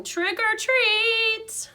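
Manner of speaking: high, slow, drawn-out call; cheerful yell. A narrator's reading. Janitor Aldo bursts into the classroom yelling trick or treat. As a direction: sing it out as you burst in.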